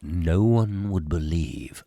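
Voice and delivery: deep, slow, drawn-out intoning; dramatic narrator. Acted. Host relishes the album's opening line, deep and slow. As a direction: savour each word like a doom-laden narrator. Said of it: "I love doing that".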